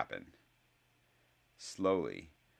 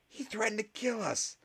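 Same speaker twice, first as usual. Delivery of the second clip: blurted accusation. Acted. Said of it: "blurted one of the other Slytherins".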